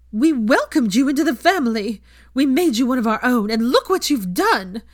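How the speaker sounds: high, loud, outraged tirade; shrill... no, loud high-pitched maternal fury; furious scolding mother. A narrator's reading. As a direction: tear into her like a betrayed mother. Molly berates Hermione furiously.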